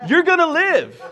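high, loud proclamation; big announcement. Acted. Comic announcing survival news, high loud proclamation.

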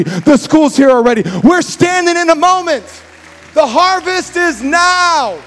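loud, high climactic preaching; preaching at full force. Acted. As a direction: build to a shouting climax. Preaching builds to shout.

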